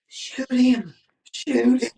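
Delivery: high, loud, urgent shout of shoot him; frantic high shout at the buddy to fire now; urgent hushed yell. Retold or imitated. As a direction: hiss it high and hard as the bird steps close. Urging buddy to shoot turkey.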